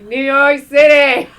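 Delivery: slow, loud, high shouted cheer. Retold or imitated. On set in front of the producer, shouting a cheer. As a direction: belt it out like a rally cry.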